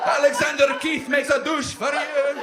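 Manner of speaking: loud, high, slow pitch; loud mock commercial slogan; ad tagline. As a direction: announce it like an ad.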